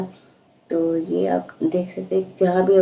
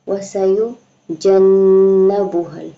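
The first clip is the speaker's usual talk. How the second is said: slow, strong chanted recitation, louder; loud Quran recitation; 7 dB louder, much slower than usual. Shown as a demonstration. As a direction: project the verse in a full recitation voice. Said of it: "teacher recites the verse".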